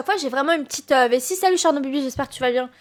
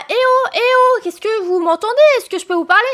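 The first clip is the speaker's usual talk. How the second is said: high raised call, trying to get heard; 5 semitones higher, 4 dB louder than usual. Retold or imitated. Streamer hailing train staff, high raised call. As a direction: hail the staff from the platform. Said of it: "Je suis là".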